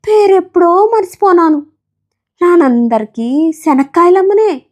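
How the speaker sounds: a tired village woman telling her lot; peanut seller voice. Acted. (పల్లెటూరి అమ్మ స్వరంలో అలసటగా)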